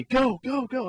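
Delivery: high mock yell; retold bench yelling. Whole bench urging the runner home, imitated yelling. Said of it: "Everyone on the bench is yelling at me".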